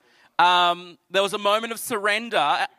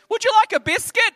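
put-on much higher, squeaky child's offer; little girl voice; 5 dB louder than usual. Four-year-old neighbour offering biscuits, squeaky high. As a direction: imitate a small girl. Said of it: "She goes".

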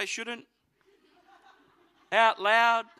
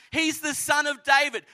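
higher, louder, excited call of "He's the Son of David"; kids calling out; 6 semitones higher, 4 dB louder than usual. Retold or imitated. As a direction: ring it out like excited kids in the temple. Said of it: "the kids are calling out".

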